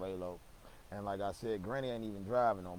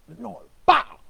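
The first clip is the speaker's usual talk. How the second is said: furious high yell; angry yell; 12 semitones higher, 18 dB louder than usual. Retold or imitated. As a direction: bark it like an enraged uncle. Uncle bursts in yelling.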